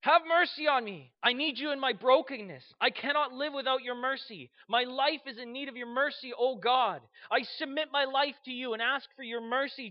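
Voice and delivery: impassioned begging at high pitch; fervent plea. Acted. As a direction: cry out a prayer for mercy. Acting a sinner's plea for mercy.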